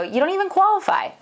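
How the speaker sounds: high, bluntly delivered rejection; a lender flatly saying no; blunt verdict. Retold or imitated. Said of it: "that we go".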